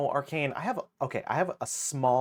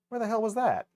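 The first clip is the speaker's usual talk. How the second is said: exasperated; 9 semitones higher, 6 dB louder, faster than usual. Real, not acted. Searching for a missed picture.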